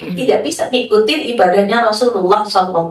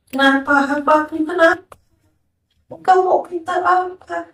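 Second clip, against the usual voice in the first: higher, tender answer; tender divine reply; 6 semitones higher than usual. Retold or imitated. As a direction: answer like a loving caller. Allah menjawab hamba dengan lembut. (jawaban lembut, nada lebih tinggi)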